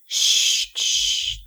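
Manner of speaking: voiceless, drawn-out shushing; hushing. A narrator's reading. Stranger silences frightened Elida, hushing. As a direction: shush her firmly with breath alone.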